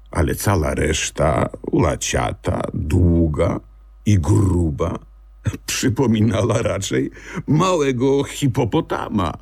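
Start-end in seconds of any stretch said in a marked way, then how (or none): none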